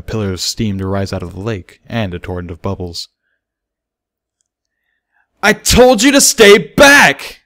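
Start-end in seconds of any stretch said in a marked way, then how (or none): 5.4-7.4 high, loud, slow panicked scream; a terrified colt screaming at an angry crowd. Acted. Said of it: "Bucky screamed".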